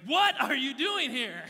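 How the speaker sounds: put-on a stern vice principal catching a kid. Vice principal catching a boy in the girls' bathroom, loud and stern. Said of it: "vice principal comes in and is like".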